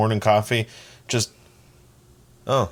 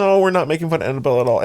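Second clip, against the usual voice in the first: fast nervous denial; 5 semitones higher, 6 dB louder, much faster than usual. Real, not acted.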